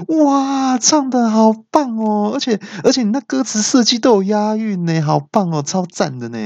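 high, far-off fangirl squeal of praise; gushing praise. Retold or imitated. Staff praising the song.

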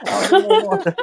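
laughing. Real, not acted. Laughing retelling dad leaving.

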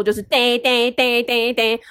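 slow, sing-song alarm bell imitation; 模仿警铃声. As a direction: ring it out like a mock alarm bell. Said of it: "警铃大作". (慢慢地模仿叮叮叮的警铃声)

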